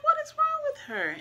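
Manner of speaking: sudden very high-pitched worried question; alarmed high squeak of a worried mom; startled alarm. Retold or imitated. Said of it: "I'm like".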